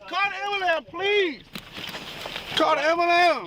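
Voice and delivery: high-pitched desperate begging; frantic plea. Real, not acted. Handcuffed man begging police for an ambulance, frantic plea. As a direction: cry out urgently for help.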